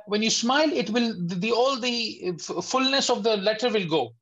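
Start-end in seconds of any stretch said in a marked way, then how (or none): none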